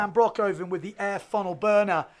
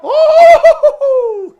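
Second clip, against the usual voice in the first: very high, long shouted "ooh"; commentator's huge howl at a crash; loud wipeout whoop; 21 dB louder than usual. Real, not acted. Reacts to a wipeout live.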